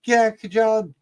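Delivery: high, sweet mock-polite voice; polite request imitated. Wife asking the swing operators to stop: high mock-polite voice. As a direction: ask the ride crew nicely, overly sweet. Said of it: "And she's like".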